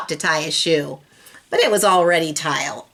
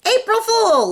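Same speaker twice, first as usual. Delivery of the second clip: high, loud little sister shout; 4 dB louder than usual. A narrator's reading. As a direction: yell it out gleefully like a little girl. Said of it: "Lola shouted".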